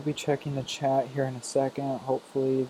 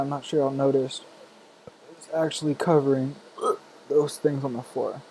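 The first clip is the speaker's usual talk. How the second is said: normal talking voice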